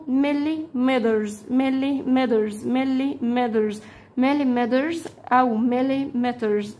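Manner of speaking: "مليمترز" said slowly, the t softened toward a d; teacher drilling "مليمترز" slowly for kids; pronunciation drill. Shown as a demonstration. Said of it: "مفيش مشكلة إن أنت تنطق التي دي".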